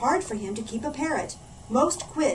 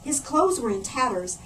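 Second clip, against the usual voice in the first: plain reading voice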